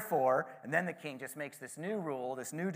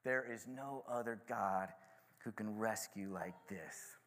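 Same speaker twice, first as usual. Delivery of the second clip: soft, slow, lower reverent repeat; savoured quietly; 4 semitones lower, 7 dB quieter than usual. Shown as a demonstration. Speaker repeating the king's phrase, quiet awe. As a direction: linger over the phrase in awe. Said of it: "I love that last phrase".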